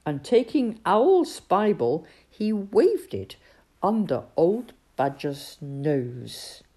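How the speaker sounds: ordinary delivery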